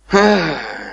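suspiro lloroso y exagerado; un gemido de llanto; sollozo fingido. Acted. Siervo llorando. (sollozo teatral)

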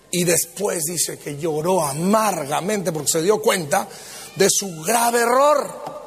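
ordinary delivery

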